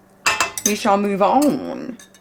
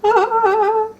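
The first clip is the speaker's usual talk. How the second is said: delighted squeal; 13 semitones higher, 4 dB louder than usual. Real, not acted. How beautiful this is.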